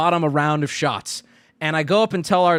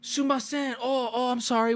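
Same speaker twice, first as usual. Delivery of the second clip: polite apology; 6 semitones higher than usual. Retold or imitated. Overly polite apology to Japanese hosts.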